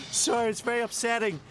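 high, wobbly show host fighting tears; tearful TV host. Acted. A host signing off the last show, close to tears. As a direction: keep breaking up as you sign off. Presenter tearfully closing the season.

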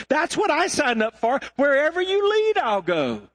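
high-pitched indignant objection, building to a vow; impassioned protest. Retold or imitated. Young seminarian objecting to God over a form, impassioned. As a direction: protest earnestly, voice climbing high.

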